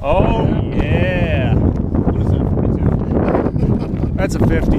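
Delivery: loud, high thrilled shout; excited whoop. Real, not acted. Angler celebrating a big pike on the ice, thrilled. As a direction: crow over the catch.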